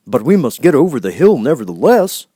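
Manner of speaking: firm, cheerful straw man; lightly voiced Scarecrow. Scarecrow telling friends they must climb on.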